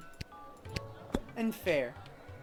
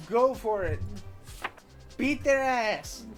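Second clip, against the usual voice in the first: cheering; 6 semitones higher, 5 dB louder, slower than usual. Real, not acted. Cheering on the pirate.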